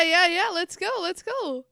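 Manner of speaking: usual speaking voice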